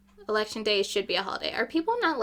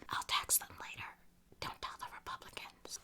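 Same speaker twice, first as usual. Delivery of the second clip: conspiratorial whisper, much softer; 16 dB quieter than usual. Real, not acted. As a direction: let the audience in on a secret. Secret aside to the camera.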